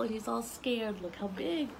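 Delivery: ordinary talk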